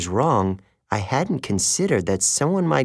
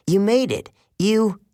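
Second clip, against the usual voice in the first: higher, excited and out of breath; breathless joy; 9 semitones higher than usual. A narrator's reading. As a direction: gasp out the relief of seeing friends. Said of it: "Grover ran up breathless".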